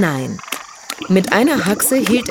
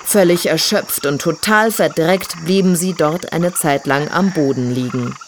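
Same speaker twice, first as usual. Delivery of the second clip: ordinary delivery, nothing marked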